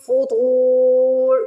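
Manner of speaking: فطور with a heavy, long to before the ra, very slow; slow Quranic recitation; slow drawn recitation. Shown as a demonstration. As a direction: stretch the to in فطور. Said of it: "اس کو فطور کر کے نہیں پڑھنا".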